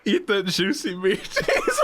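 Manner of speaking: laughing. Real, not acted. Mock-sultry teasing, laughing.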